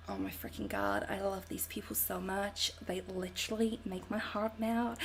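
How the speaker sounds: soft, near-whispered American adoration; American accent. Retold or imitated. Streamer privately gushing over viewers chatting, in a hushed American accent. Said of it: "I don't know why I become American".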